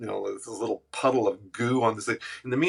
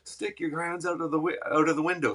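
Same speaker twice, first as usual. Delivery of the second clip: cop orders; 8 semitones higher than usual. Retold or imitated.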